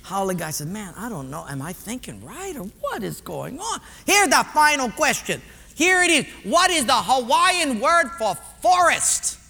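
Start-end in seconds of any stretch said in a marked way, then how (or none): 0.7-3.8 hushed self-doubt. Acted. Haole guy questions his own thinking, quiet and bewildered. 4.1-9.4 put-on raised, local-accented quiz boss; personnel guy, insistent. Personnel guy puts the next question, loud and insistent.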